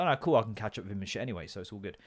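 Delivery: quick, quiet reassurance; fast and quiet. Retold or imitated. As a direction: rush it out softly. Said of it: "so I was like".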